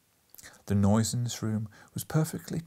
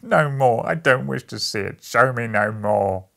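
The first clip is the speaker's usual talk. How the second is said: desperate cry; 9 dB louder than usual. A narrator's reading. Scrooge refuses to watch more, loud and desperate. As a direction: shout him down in anguish. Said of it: "cried Scrooge".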